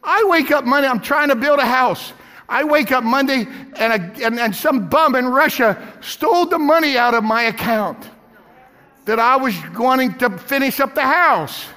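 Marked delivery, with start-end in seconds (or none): none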